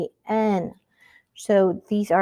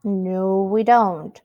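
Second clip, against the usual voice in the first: usual speaking voice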